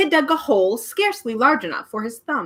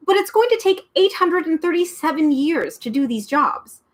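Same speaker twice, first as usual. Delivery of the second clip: Milo pleading; 8 semitones higher than usual. A narrator's reading. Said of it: "he said, tugging".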